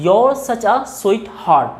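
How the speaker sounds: "your" for you are, slow and clear; contracted you're. Shown as a demonstration. Teacher demonstrates you're to students, slowly. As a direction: merge you are into a single your sound. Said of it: "you are को हम बोलते हैं your".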